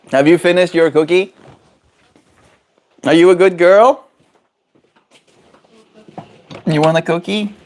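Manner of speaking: high, loud sing-song pet talk; talking to a pet bird. Real, not acted. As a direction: coo up at the bird cheerfully. Coaxing his pet bird Zoe.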